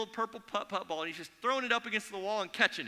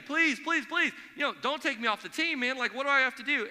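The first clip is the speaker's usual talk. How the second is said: high, louder pleading; begging; 7 semitones higher, 4 dB louder than usual. Retold or imitated. Cut player begs his coach with a high, loud plea. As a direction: plead desperately to stay on. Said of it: "he starts begging".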